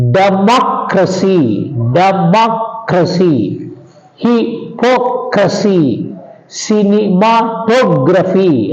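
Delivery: "democracy, democracy, hypocrisy, cinematography" slowly, stressing MOC, MOC, POC, TOG; slow, syllable-split "democracy, democracy, hypocrisy, cinematography", weight third from last. Shown as a demonstration. Teacher demonstrating sixth rule, "democracy, democracy, hypocrisy, cinematography" stressed third from last. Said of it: "all these words take stress on the third syllable from the end".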